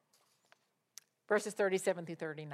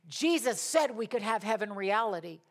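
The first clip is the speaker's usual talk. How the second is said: ordinary delivery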